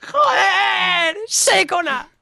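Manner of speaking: high, drawn-out cry of triumph; ecstatic shouting. Real, not acted. Player after the capture, ecstatic shouting. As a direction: wail it out in jubilant relief.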